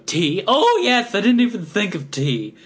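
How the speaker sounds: a giddy tea-party loon struck by an idea; cartoon impression. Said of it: "that was a B minus impression".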